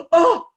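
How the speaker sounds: sharp gasp into a loud, high "oh"; panicked gasp. Retold or imitated. Parent hearing son faces a hearing, panicked gasp. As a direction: imitate a sudden intake of shock. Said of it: "When you just go".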